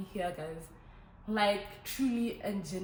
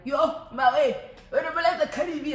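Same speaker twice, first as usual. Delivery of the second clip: loud, higher-pitched mock calling out; imitated friends' shout; 8 semitones higher, 10 dB louder than usual. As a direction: holler it like excited friends demanding music.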